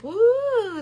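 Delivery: long high "woo"; drawn-out playful whooping noise; imitated airy whoop. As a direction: mimic a flailing inflatable with a high swoop. Imitating dealership blow-up doll.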